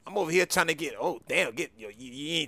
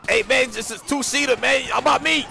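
rising protest; 9 semitones higher than usual. Acted. Left behind, protesting.

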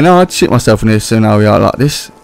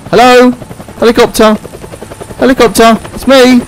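calling out; 11 semitones higher than usual. Real, not acted. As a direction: call up to the helicopter. Calling out to a helicopter.

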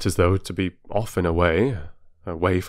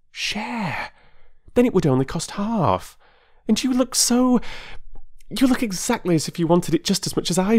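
high, rushing, pleading voice of an excitable wife; carried away, words tumbling out higher and higher; eager Mrs Wilkins; 11 semitones higher than usual. A narrator's reading. Mrs Wilkins urging a stranger to escape, eager and high.

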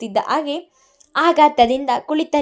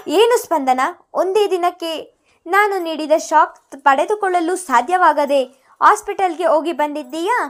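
mocking taunt; 6 semitones higher, 5 dB louder than usual. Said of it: "ಎಂದು ನಕ್ಕವಳು".

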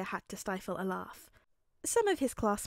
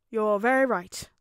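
loud, full hero voice with a smile; big, kindly mentor's agreement; smiling All Might; 10 dB louder than usual. A narrator's reading. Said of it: "All Might smiled softly".

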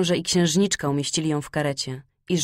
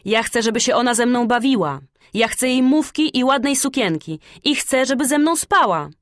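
put-on high, loud, demanding little girl; loud bratty demands pitched high; spoiled wild child; 6 semitones higher, 5 dB louder than usual. A narrator's reading. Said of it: "wołało dzikie, rozpuszczone dziecko".

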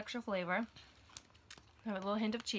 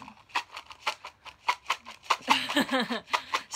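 breathy burst of laughter; loud laugh; 3 semitones higher, 7 dB louder than usual. Real, not acted. Laughing at toddler.

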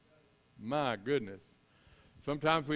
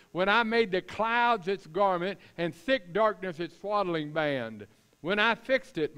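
raised, loud, emphatic divine questioning; Lord's voice; 4 semitones higher, 5 dB louder than usual. Acted. God listing creation to Job, loud and commanding. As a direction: drive each line home slowly and forcefully. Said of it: "in the Lord's voice here".

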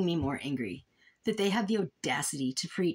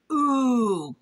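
surprised ooh; 6 semitones higher, 7 dB louder than usual. Real, not acted. Reacting to the complaint story.

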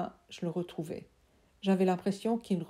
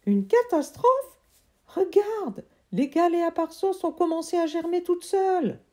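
much higher, loud delighted voice; thrilled uncle; 11 semitones higher, 6 dB louder than usual. Acted. Uncle thrilled by his sprouting seeds, high and loud. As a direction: burst with delight.

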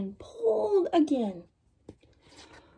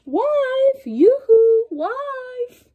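high sing-song call, much louder; farmer calling out; 12 semitones higher, 7 dB louder, much slower than usual. Acted. Farmer calling his wife from the field, high sing-song call. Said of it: "So he called his wife".

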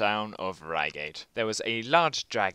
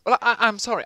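flustered mayor; 11 semitones higher, 10 dB louder, faster than usual. Acted. Mayor's daughter picked for lottery.

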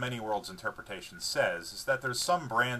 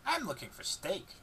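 slow, higher dog voice, hopeful; 8 semitones higher, 3 dB quieter than usual. A narrator's reading. Narrator voicing the dog, hopeful dog voice. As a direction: sniff out the steak in a hopeful pup voice. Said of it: "wagging her tail hopefully".

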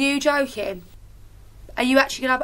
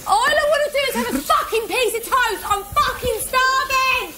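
high-pitched real wail of protest, first words dragged out; hungry outburst; 5 semitones higher than usual. Real, not acted. Starving contestant demanding a piece of toast, distraught wail. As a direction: wail it out, desperate to be fed.

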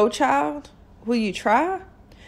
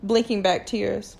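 ordinary delivery

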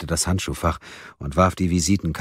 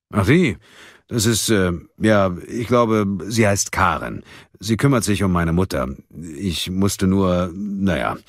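hesitant, halting man's answer; lightly voiced Munk, evasive; 3 semitones higher, 3 dB louder than usual. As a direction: stall and drag out the words, a touch awkward. (leicht ausweichend, zögernd und stockend)

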